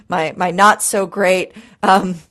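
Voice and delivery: ordinary talk, nothing marked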